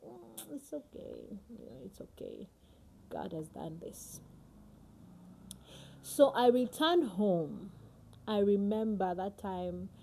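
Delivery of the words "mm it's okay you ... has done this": hushed brush-off. Retold or imitated. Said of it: "I'd be like".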